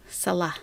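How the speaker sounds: Salah said quietly as suh-LAH; quick light first syllable in Salah, then LAH; correct stress. Shown as a demonstration. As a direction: model Salah softly, second syllable stressed. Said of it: "emphasis is always on the wrong syllable".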